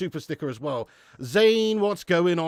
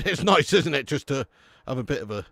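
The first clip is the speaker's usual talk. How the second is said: ordinary talk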